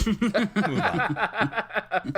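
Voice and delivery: laughing through the words; real laugh breaking into the line. Real, not acted. Laughing at his own bad accent.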